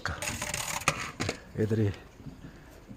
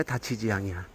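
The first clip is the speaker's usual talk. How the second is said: usual speaking voice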